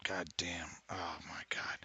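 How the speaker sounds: soft whispered oh my God. Real, not acted. Gamer reacting quietly to the game, whispered. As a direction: breathe it under the breath. Muttered aside while gaming.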